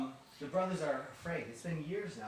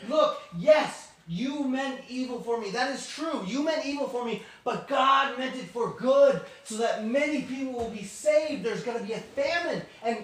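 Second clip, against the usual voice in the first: loud, high, urgent proclamation; fervent acted reassurance; impassioned declaration; 7 semitones higher, 7 dB louder than usual. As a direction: preach forgiveness at full force. Joseph passionately forgives his brothers.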